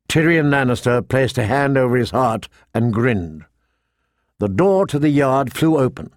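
plain reading voice

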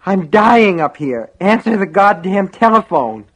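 desperate, loud, high-pitched plea; strained, frantic yelling; ragged gasp. A narrator's reading. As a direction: cry out as a dying man struggling for air. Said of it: "Henry gasped raggedly".

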